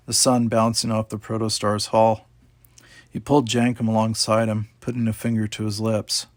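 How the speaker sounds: ordinary delivery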